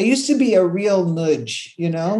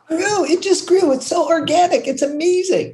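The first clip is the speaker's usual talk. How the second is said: delighted; 9 semitones higher than usual. Real, not acted. Delighted at her idea's growth.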